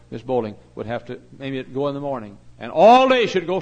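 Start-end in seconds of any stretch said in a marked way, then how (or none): 2.7-3.6 high, loud, stretched emphasis; emphatic preaching cry. Real, not acted. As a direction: belt it out for emphasis. Preacher stresses her long soul winning.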